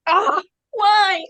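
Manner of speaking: высоко и громко, с притворным отчаянием, «Ah, why?»; mock anguished wail. Fangirl voicing her anguished reaction.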